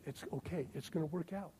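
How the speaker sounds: soothing mother. Retold or imitated. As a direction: hush it gently like comforting a child at a sad movie. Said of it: "She would say".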